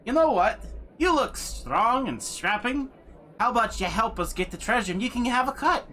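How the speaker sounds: high, eager put-on voice for the adventurer; smarmy adventurer. Same NPC makes an offer.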